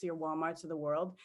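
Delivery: normal talking voice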